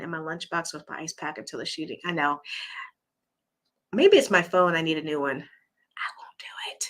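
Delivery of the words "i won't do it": slow unvoiced whisper. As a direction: whisper it like a confession. Aside reply to chat.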